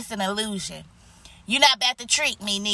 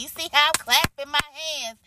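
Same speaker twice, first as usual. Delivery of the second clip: ordinary talk, nothing marked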